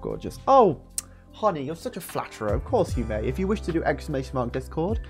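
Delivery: ordinary delivery, nothing marked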